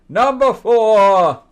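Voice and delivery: game-show countdown voice; announcer call. Real, not acted. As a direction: boom out the number like a show host. Countdown host announcing next entry.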